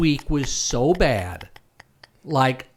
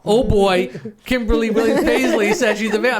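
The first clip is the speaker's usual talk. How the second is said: mock excited quote; 7 semitones higher, 3 dB louder, faster than usual. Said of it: "from Doctor Piano Man saying".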